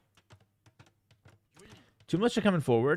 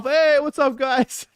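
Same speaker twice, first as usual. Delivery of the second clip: high, put-on cheery greeting; fake-friendly hey to cover being caught; celebrity caught sneaking out greets fans, high and fake-cheerful; playing it off; 10 semitones higher, 5 dB louder, slower than usual. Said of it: "he plays it off".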